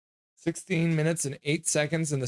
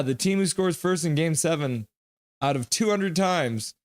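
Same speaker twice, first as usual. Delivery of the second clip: ordinary talk, nothing marked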